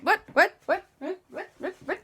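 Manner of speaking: rapid repeated yelps; panicked what. Acted. Andy realising he has wings, panicked repeats. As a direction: fire off the whats in a panic.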